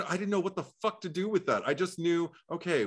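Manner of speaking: ordinary talk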